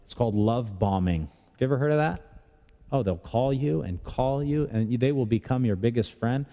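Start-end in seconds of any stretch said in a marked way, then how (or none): none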